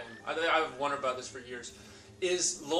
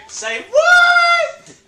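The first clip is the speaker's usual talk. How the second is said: much higher, shouted squeaky Mario; 15 dB louder than usual. Retold or imitated. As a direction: yelp it like a cartoon plumber. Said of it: "He'd say".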